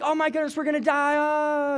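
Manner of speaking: high, hammed-up panicked cry; mock panic. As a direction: play the scared disciples for laughs, hammed up. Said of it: "it's all dramatic".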